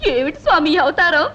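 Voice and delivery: ordinary talk, nothing marked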